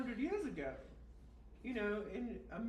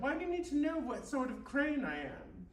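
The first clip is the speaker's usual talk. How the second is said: defensive crane; 4 semitones higher, 5 dB louder than usual. Acted. Crane snaps back defensively.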